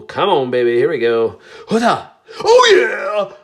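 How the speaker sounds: loud, high whoop over a good pull; thrilled pack-opening shout; excited cheer. Real, not acted. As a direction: celebrate the card out loud.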